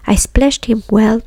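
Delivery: plain reading voice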